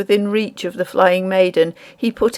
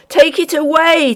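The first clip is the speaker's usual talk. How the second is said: high, frantic plea to lift the load; the miller begging the ghost to remove the weight; terrified shriek; 9 semitones higher, 3 dB louder than usual. A narrator's reading. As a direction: shriek it out in panic. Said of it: "he shrieked".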